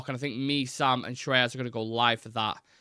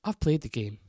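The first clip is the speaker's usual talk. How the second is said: put-on Graham Souness accent. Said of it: "terrible Graham Souness accent".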